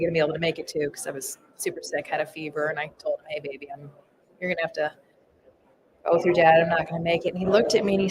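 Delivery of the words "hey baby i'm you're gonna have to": normal talking voice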